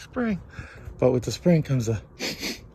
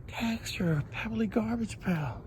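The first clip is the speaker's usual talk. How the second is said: whisper; 8 semitones higher, 7 dB quieter than usual. Real, not acted. Examining texture closely.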